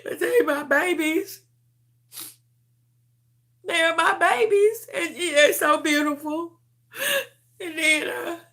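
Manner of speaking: fighting back sobs while she speaks; crying. Real, not acted. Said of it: "I'm sorry for crying".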